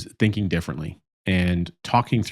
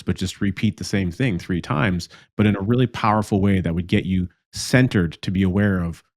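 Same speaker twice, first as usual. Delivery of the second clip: ordinary talk, nothing marked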